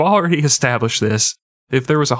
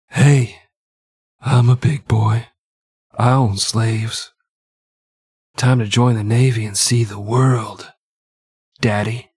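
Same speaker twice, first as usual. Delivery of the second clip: slow, sultry phone sex operator voice; George Washington; slower than usual. Acted. Host shows listeners his George Washington voice, sexy and slow. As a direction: purr it slowly, with long pauses between phrases. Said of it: "sounds like a sexy phone sex operator".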